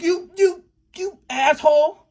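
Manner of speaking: high, stammering mock outrage; halting insult. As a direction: imitate someone groping for the insult, slowing down.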